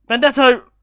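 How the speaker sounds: high, very loud yell of the name; excited shout. Real, not acted. Gamer shouting his striker's name at a miss. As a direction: scream it as the shot goes wide.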